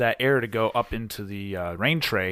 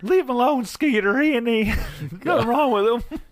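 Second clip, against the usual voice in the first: hillbilly voice; 11 semitones higher than usual. Acted. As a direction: play a rowdy backwoods guy. Another hillbilly figure.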